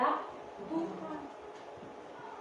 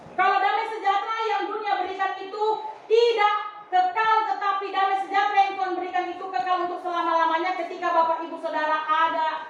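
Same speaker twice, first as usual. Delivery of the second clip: suara khotbah lebih tinggi dan lantang, kata kekal ditekan pelan; berkhotbah penuh semangat; 6 semitones higher, 3 dB louder than usual. Acted. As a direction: naikkan suara seperti pengkhotbah bersemangat. Preacher raising voice on promise. (pengkhotbah meyakinkan jemaat dengan suara berkhotbah lebih tinggi)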